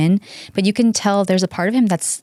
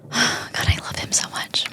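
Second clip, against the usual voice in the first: sigh, then whisper it softly; breathy swooning whisper; dreamy whisper; 7 dB quieter than usual. Real, not acted. Swooning over the driver.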